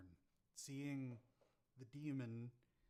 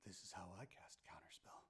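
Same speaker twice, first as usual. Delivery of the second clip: whispering; 6 semitones lower, 6 dB quieter than usual. Acted. Rogue's line to dying villain.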